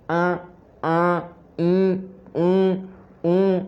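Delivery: each vowel अ आ इ उ said through the nose; nasal vowels. Shown as a demonstration. Teacher demonstrating nasal vowels one by one. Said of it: "यह नाक की सहायता भी ले रहे हैं".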